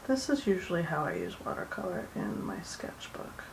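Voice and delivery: soft, half-whispered murmur; super soft voice. Real, not acted. Artist explaining her sketchbook watercolor habit, super soft voice. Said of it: "in her super soft voice".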